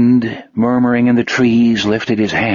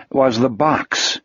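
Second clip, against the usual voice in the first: ordinary delivery, nothing marked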